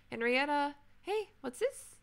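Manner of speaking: sharp high call to stop her pet. Real, not acted.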